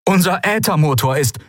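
high, urgent stranger's voice; frightened ship captain calling; desperate distress call. Acted. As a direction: plead for rescue over static.

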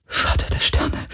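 breathy frightened whisper. A narrator's reading. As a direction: whisper it so nobody hears. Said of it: "flüsterte Kirai".

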